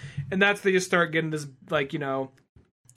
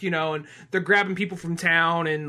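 normal talking voice

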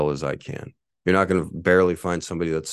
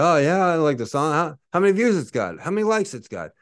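higher, lightly voiced dismissive listener; mock listener voice; 9 semitones higher, 3 dB louder than usual. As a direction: toss it off like an unimpressed listener, pitched higher. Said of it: "if people hear it, like".

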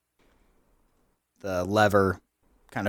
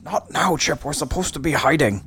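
high-pitched, fussy clock character; flustered scolding; 6 semitones higher than usual. Acted. Hiding clock tells Chip off, high-pitched flustered scolding. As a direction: shoo a friend off in a higher voice.

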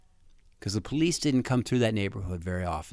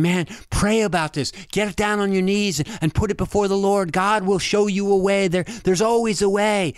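higher, lightly voiced earnest youth pastor; 9 semitones higher than usual. Youth pastor urging him to pray, a hint of preaching. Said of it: "I just felt my youth pastor saying".

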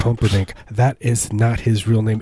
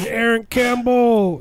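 backwards voice; 13 semitones higher, slower than usual. Pretend. Mock heavy-breather voice.

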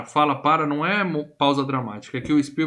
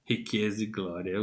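low, soft and slow, savouring it; hushed awe; 7 semitones lower, 10 dB quieter, slower than usual. Retold or imitated. Podcaster quoting the film hero eyeing the cloth, hushed awe. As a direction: murmur it as if dazzled by treasure. Quotes Indy awed by the cloth.

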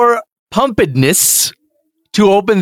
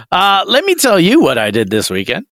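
ordinary talk

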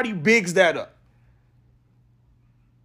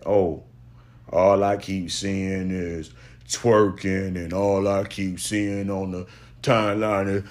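lower, slow mocking whine; lightly voiced whiny critic; mocking complainers; 11 semitones lower than usual. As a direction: parody the timeline complainers, much lower and slower. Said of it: "People saying".

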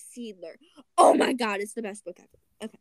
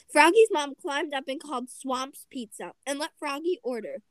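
plain reading voice

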